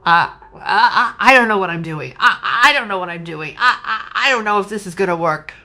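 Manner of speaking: flustered stammering with a shaky laugh; nervous stammer. Retold or imitated. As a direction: stutter like you're panicking about the unknown. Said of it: "a lot of you were like".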